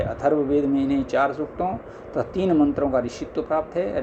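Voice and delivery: ordinary talk, nothing marked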